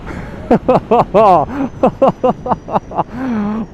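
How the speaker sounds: long, high burst of real laughter; exhilarated laugh. Real, not acted. Thrilled by the acceleration.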